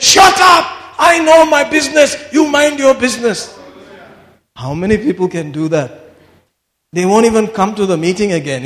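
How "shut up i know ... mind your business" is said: much higher and louder furious retort; angry shout. Retold or imitated. Wigglesworth shouting back at the heckler, furious and loud. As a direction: bark the heckler down.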